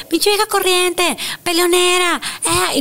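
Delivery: high, slow sneering gossip; mocking critics. [name] mocking what people comment about her, sneering. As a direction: imitate the critics with scorn. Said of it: "Todos los comentarios".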